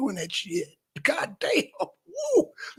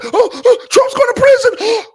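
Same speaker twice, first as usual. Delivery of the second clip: high, loud shocked squeal; mock panicked fan; 13 semitones higher, 10 dB louder than usual. Mocking fans who think Trump is going to prison, in a shrieking panic. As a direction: gasp out the fear like a hysteric. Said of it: "trying to imitate the ones".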